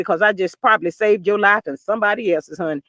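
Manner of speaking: normal talking voice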